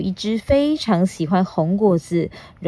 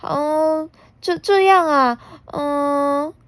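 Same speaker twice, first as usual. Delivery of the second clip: timid whimper; 8 semitones higher, much slower than usual. Acted. Shy T-rex tries roaring.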